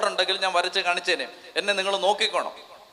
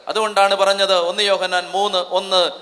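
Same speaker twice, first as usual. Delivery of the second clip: ordinary talk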